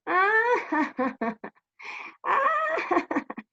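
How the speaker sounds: acted "aah" into ha-ha-ha; mocking storybook laugh. Shepherd laughs at villagers fooled twice, mock laughter. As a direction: laugh in staccato, hammed-up peals. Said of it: "él se rió y les dijo".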